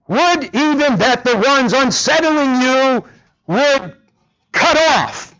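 acted apostle's indignant outburst; holy indignation. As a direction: let the wish burst out in outrage. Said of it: "his holy anger".

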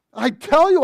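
high, loud protest; loud insistence. Acted. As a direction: shout him down. Peter insists.

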